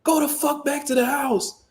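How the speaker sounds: high-pitched mock snap; imitated snapping. Officer orders stepdad back inside, mock snapping. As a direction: imitate her losing it, pitch way up without full volume. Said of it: "She just snapped".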